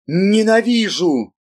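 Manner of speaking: furious high roar; angry roar. A narrator's reading. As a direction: roar out the hatred. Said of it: "гневно взревел". (гневный рёв)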